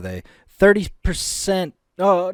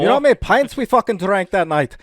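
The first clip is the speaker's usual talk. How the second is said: put-on rowdy Irish drunk; 4 semitones higher, 4 dB louder, faster than usual. Joins drunk Irish bit.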